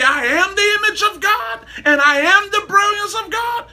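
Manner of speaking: high, loud proclamation of divine identity; soaring, bold claim of glory; proud declaration. Retold or imitated. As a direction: announce it like you own the room. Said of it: "But when you say".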